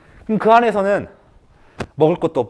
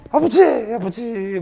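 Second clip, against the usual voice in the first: hammed-up cry, high then fading; mock wailing cry; 5 semitones higher, slower than usual. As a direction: wail for father, then trail off. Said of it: "막 오열을 팡팡 해". (아버지를 부르며 흉내 낸 오열)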